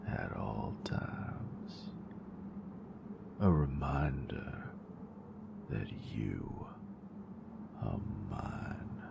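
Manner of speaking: soft, close, teasing whisper to a lover; intimate whisper. Acted. Teasing her nerd with lights, close intimate whisper. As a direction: whisper it close, possessive and playful.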